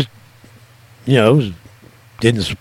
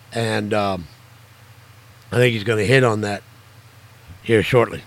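normal talking voice

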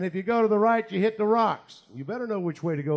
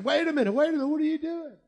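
alarmed; 9 semitones higher, faster than usual. Retold or imitated. Startled patient interrupts prayer.

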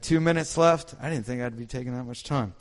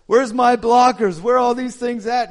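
higher, louder and slowed, searching shout; frantic quarterback; 10 semitones higher, 9 dB louder than usual. Retold or imitated. A quarterback calls for his blockers, high and loud. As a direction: call out for your missing blockers. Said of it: "you might be the quarterback".

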